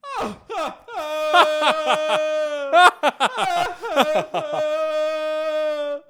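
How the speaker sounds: high, loud, wild cackle; shrill cackling laugh. Shown as a demonstration. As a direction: cackle like an eighties singer. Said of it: "What if I laughed like that?".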